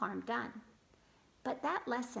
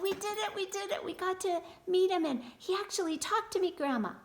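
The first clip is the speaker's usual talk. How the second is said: high, loud burst of happy news; excited child; 8 semitones higher, 7 dB louder than usual. A narrator's reading. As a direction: gush it to Grandma, high and thrilled. Child tells grandparents her news.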